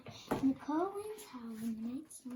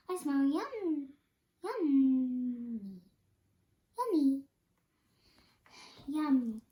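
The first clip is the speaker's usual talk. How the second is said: savoring yummy; 6 dB louder than usual. Acted. Badger sniffing, savoring food.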